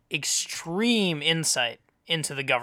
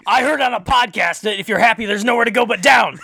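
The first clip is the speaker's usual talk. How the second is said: louder, higher shouted comeback; mock angry retort; 5 semitones higher, 7 dB louder than usual. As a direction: throw it at the stranger in hammed-up anger. Joins the acted angry rant.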